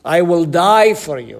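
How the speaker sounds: loud, proud promise; loud boastful oath of loyalty; boastful vow. Retold or imitated. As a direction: declare it big like a braggart. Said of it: "was so arrogant and so boastful".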